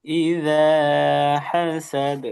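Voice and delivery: ইযা with careful dhal and long vowel; drawn-out model of ইযা হাসাদ; dhal makhraj demonstration. Shown as a demonstration. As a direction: chant ইযা হাসাদ, bouncing the final d. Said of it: "দালের মাখরাজ".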